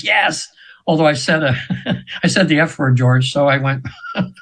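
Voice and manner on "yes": a high, mock yell of yes; retold scream. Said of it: "I screamed".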